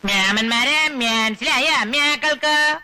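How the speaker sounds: slow Namboothiri dialect, drawn-out words. Shown as a demonstration. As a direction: ask it in Namboothiri speech, slowly. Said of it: "Namboori ഭാഷ ചോദിക്കണം".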